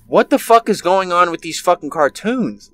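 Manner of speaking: normal talking voice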